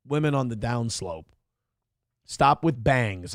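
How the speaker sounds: ordinary talk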